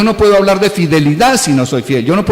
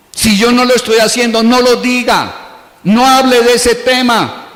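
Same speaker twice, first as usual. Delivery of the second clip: higher, insistent sermon command; emphatic warning; 6 semitones higher than usual. Acted. Preacher warning listeners not to teach what they don't live, raised pitch. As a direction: drive the rule home.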